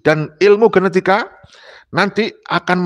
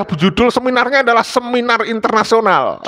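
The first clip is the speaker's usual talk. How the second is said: normal talking voice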